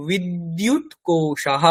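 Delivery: বিদ্যুৎ কোষাহ slow, breathy -ah ending; Sanskrit visarga. Shown as a demonstration. Teacher correcting the word for battery, slow. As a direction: draw out কোষাহ and breathe the final h. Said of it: "মনে রাখতে হবে যে".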